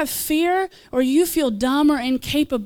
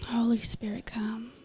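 breathy whispered invocation, much softer; whispered prayer; 3 semitones lower, 13 dB quieter than usual. Real, not acted. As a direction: barely whisper the call to the Spirit. Softly invoking the Spirit.

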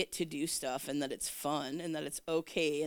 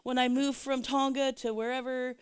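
higher-pitched, mildly astonished retelling; imitated freaking out; 7 semitones higher than usual. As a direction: act out his amazed outburst, lightly. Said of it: "he's just freaking out".

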